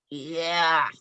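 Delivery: raspy, drawn-out spirit growl; scary growled yeah. Retold or imitated. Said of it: "like, this scary yeah".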